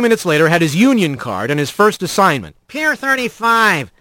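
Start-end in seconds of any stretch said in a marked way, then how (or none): 2.7-3.9 skunk clerk. A narrator's reading. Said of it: "the skunk behind the desk said".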